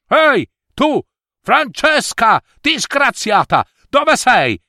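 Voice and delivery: high-pitched, scolding call; angry calling. Acted. Mother chasing Francesca to punish her, angry call. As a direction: hunt down the culprit by shouting.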